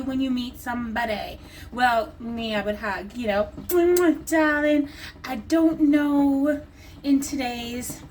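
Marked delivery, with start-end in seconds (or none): 3.7-4.9 high, louder hammed-up luvvie "Mwah, darling"; mock air-kiss. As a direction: play the gushing socialite. Pretend air-kiss greeting.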